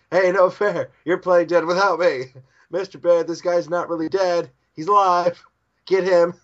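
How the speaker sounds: put-on high, loud childish protest; shrieky cartoon indignation; indignant tattling. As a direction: tattle loudly on a friend. Kagura protests and tattles to bear.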